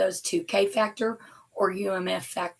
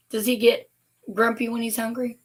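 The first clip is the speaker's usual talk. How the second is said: ordinary talk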